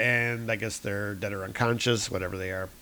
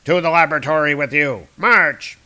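louder, fast barked command; guard's order; 2 semitones higher, 7 dB louder than usual. Acted. Guards marching Doom to the lab, loud. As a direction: order the prisoner off. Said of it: "guards command Doom".

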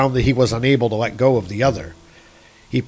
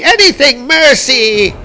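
high, loud pleading cry; begging shout; 13 semitones higher, 8 dB louder than usual. A narrator's reading. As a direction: beg at the top of your voice. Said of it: "shouted Loki".

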